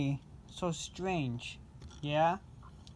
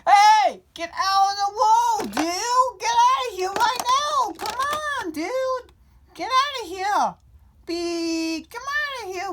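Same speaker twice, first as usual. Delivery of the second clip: much higher, louder furious yelling; road rage screaming; 8 dB louder than usual. Pretend. Pretend driver screaming at someone blocking the road, furious. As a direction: scream at the car blocking you.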